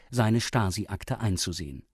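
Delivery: ordinary delivery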